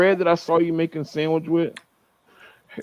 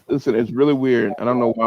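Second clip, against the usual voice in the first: ordinary delivery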